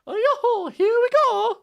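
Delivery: very high, loud, squeaky cartoon voice; squeaky Mario impression. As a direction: shriek it like a bad Mario. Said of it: "Can't do a good Mario impression".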